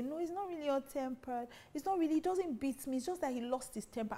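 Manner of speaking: defensive wife. Retold or imitated. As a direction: play a wife brushing off his temper. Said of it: "I hear a lot of people say".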